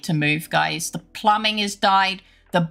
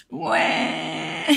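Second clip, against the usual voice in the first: small, soft whimpering "no"; mock despair; 4 semitones higher, 6 dB quieter, much slower than usual. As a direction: imitate a helpless, laughing wail. Re-enacts dismay as wall collapsed.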